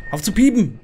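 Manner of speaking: high, loud nervous outburst; exasperated yell. Real, not acted. Player to the beeping motion sensor, exasperated yell. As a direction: yell at it, pitch jumping up.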